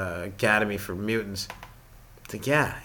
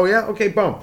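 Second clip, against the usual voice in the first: cocky retort; 10 semitones higher, 4 dB louder than usual. Retold or imitated. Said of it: "I go".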